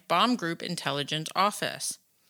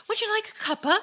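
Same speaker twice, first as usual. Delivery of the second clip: put-on high, prim British accent offering tea; faster than usual. Offering the alien tea in a high British accent. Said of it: "I've never heard you do a British accent".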